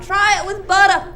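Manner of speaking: high, loud cracked croak; cracked rambling vagrant. Acted. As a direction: call out like a cracked old vagrant. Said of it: "Somewhere the vagrant's voice comes out".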